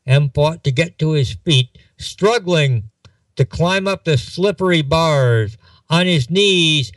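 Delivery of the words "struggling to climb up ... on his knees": ordinary delivery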